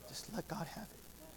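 breathy hush of surrender; a soft private whisper of prayer. Acted. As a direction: let it go in a whisper to God.

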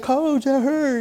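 high, small voice imitating a hurt kid; complaining player. Hurt player whines to his coach, mock complaint.

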